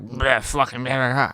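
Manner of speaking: mock slurred drunken gibberish; drunk babble. Storyteller mimics the drunk friend in the car, sloppy babble. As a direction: imitate a wasted guy blabbering nonsense. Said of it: "He's drunk as fuck".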